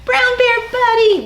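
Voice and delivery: high, loud, cheery welcome; excited greeting. Acted. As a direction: greet a friend with delight. Greeting the bear puppet.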